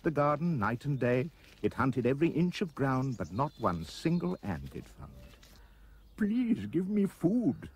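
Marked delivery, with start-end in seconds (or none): 6.2-7.7 high, drawn-out creature wail; pleading cry. A narrator's reading. Starving anteater begs Roy for food, crying out. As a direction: beg slowly, pitched way up. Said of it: "the creature cried".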